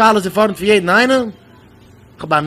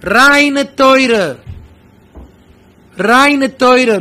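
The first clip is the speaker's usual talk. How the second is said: raised, louder exclamation; impassioned cry; 3 semitones higher, 5 dB louder, much slower than usual. Real, not acted. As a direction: proclaim it loudly, high and emphatic. Impassioned cry amid the talk.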